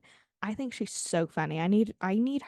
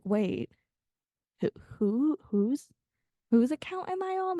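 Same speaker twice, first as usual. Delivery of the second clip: mock-baffled, pitch jumping high at the end; acted confusion; 5 semitones higher than usual. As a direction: stammer into a puzzled, high-pitched question. Acts out a confused viewer's reaction.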